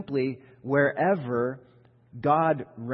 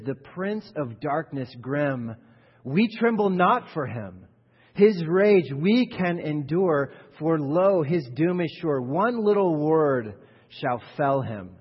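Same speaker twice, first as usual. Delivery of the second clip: slow, rising, louder recitation of the hymn lines; hymn recited; 2 semitones higher, 6 dB louder, slower than usual. Acted. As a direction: proclaim the hymn with building force. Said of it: "he puts it like this".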